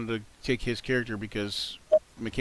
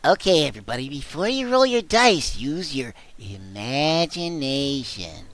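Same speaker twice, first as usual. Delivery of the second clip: SpongeBob voice; 4 dB louder, slower than usual. Acted. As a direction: play the cartoon sponge as DM. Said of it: "wouldn't he talk like that".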